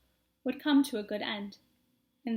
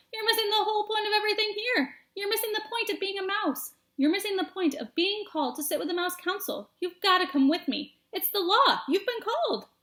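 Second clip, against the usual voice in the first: higher, urgent nagging; frantic scolding; 8 semitones higher than usual. Acted. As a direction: lecture him, exasperated. Furlow lecturing brother.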